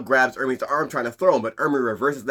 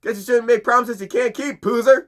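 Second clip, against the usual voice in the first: raised, taunting drill-sergeant voice; mocking drill instructor; 9 semitones higher than usual. Ermi taunts Kilowog over the lost rookie, mocking. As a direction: sneer the promise back at him. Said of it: "tells Kilowog".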